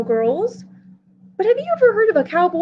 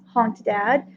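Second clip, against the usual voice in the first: plain reading voice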